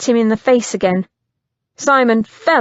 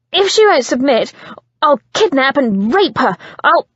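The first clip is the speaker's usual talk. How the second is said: vicious threat; 4 semitones higher, 3 dB louder than usual. Acted.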